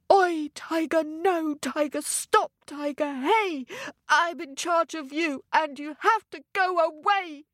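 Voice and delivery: high, urgent telling-off of a tiger; commanding rhyme. Acted. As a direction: chant a firm order at the tiger. Ted commands his tiger with rhyme.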